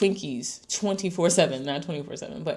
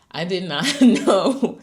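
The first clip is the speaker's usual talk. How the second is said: laughing through the words, drawn out and louder; a real laugh breaking into it; 3 semitones higher, 8 dB louder, slower than usual. Real, not acted. Realizing resting was news, laughing. Surprised by own discovery.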